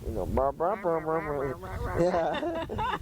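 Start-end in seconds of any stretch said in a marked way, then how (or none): 0.3-2.0 slow muted trombone brr brr; Charlie Brown's teacher. Retold or imitated. Imitating Charlie Brown's teacher droning at the dog. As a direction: drone like a muffled teacher's horn. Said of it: "like Charlie Brown's teacher".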